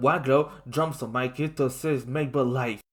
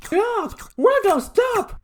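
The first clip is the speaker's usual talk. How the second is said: very high, loud cry to stop; desperate shout; 8 dB louder than usual. A narrator's reading. Lincoln shouts at Waglo to stop. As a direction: scream at him to stop. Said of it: "Lincoln shouted".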